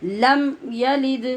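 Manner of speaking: slow, drawn-out tajweed chant; slow Quran recitation. Shown as a demonstration. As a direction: recite each word long and measured. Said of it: "recitation demo".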